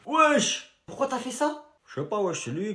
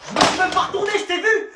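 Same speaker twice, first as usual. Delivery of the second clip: normal talking voice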